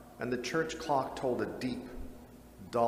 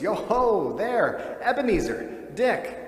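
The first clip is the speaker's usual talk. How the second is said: higher, louder hearty call; jovial call; 7 semitones higher, 4 dB louder, slower than usual. Acted. Fezziwig calling his apprentices, jovial. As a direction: call out like a merry old master. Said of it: "called out in a jovial voice".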